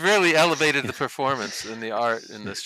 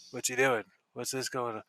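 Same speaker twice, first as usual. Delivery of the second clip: puzzled crowd murmur; 2 semitones lower, 9 dB quieter than usual. Acted. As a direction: voice a baffled audience under your breath. Voicing puzzled audience members murmuring.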